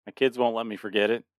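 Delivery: usual speaking voice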